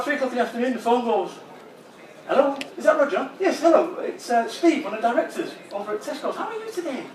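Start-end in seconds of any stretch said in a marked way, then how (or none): 2.3-3.3 lightly voiced chirpy director; a hint of a breezy boss on the phone; phone call voice. 3.4-7.0 high, chirpy fake-friendly boss; cheery director. Voices director on the phone.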